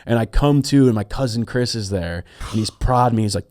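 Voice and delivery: ordinary talk, nothing marked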